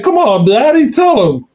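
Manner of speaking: imitating Larry. The caller ribbing the host about his cousins, a high teasing voice. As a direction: needle him like a grinning caller. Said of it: "he's like".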